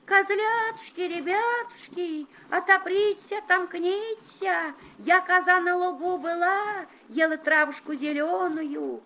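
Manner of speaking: very high thin wolf singing; wolf in disguise. Acted. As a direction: sing high and sweet, faking mother. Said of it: "да тоненьким голоском запел".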